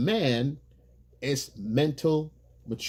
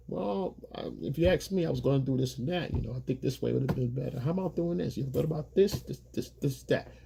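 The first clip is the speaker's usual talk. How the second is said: softer, tentative offering of ideas; soft suggestion; 6 dB quieter than usual. Retold or imitated. Man gently suggesting a better plan to his girlfriend, soft. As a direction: ease it in gently, don't push.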